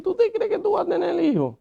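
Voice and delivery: very high, fast disbelieving question; incredulous. Retold or imitated. Husband challenges his wife about having children, incredulous. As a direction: squeak it out in disbelief, quickly.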